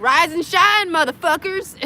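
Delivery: high, loud mock wake-up call; hammed-up morning shout at the kids; mock wake-up yell. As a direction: burst in and yell them awake. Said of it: "barge into their room and be like".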